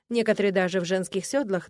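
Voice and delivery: plain reading voice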